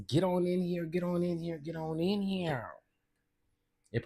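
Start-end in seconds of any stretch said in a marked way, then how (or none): 0.1-2.7 lightly voiced Armand, a bit higher and softer; a hint of Armand calling folks in; host in a light Armand voice welcoming viewers. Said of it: "In my Armand voice".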